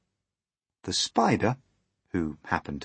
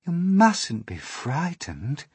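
slow, higher, soothing voice; kindly ladybird; 9 semitones higher, 5 dB louder, slower than usual. A narrator's reading. Ladybird comforting frightened James, kindly. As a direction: reassure slowly with gentle high swoops. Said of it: "the ladybird said kindly".

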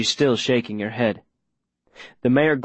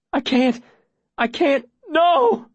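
anguished panic; slower than usual. Acted. Boy about to betray a secret, anguished cry. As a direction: cry out as the resolve gives way. Anguish watching her drown.

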